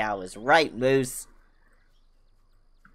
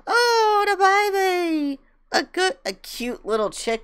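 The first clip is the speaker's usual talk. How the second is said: much higher, louder, slow squeal; squealing coo; 8 dB louder, slower than usual. Real, not acted. Squealing at baby chick.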